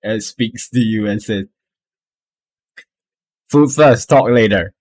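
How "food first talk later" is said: higher and louder, curt order; gruff kobold demand. Acted. Commanding kobold sets terms for the party. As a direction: bark the terms at them.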